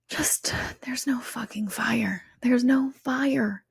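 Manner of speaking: slow, soft reassuring whisper; calm them down gently. Real, not acted.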